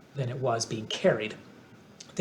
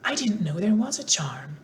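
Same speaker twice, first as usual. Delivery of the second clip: surprised; 7 semitones higher than usual. A narrator's reading. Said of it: "in surprise".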